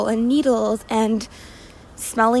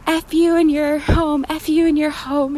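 mild mock yell; 5 semitones higher than usual. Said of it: "he started yelling".

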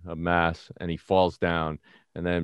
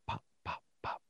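tiny, voiceless little pops; very soft popping, barely any voice; muffled gunshot imitation; 18 dB quieter than usual. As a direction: imitate gunfire heard as faint puffs. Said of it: "it just sounded like".

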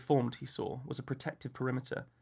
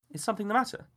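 higher, gentle woman's voice; a woman asking with concern; 8 semitones higher, 4 dB louder, faster than usual. A narrator's reading. Felicity asks Clarence, higher woman's voice.

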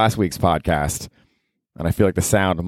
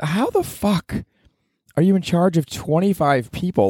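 much higher, slow disbelieving; incredulous; 9 semitones higher than usual. Real, not acted. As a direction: stretch it out in disbelief. Incredulous inner thought.

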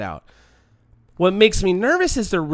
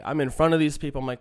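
usual speaking voice

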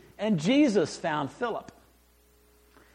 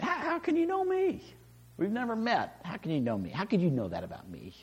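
astonished question; 7 dB quieter, faster than usual. Retold or imitated. Nathanael astonished by Jesus.

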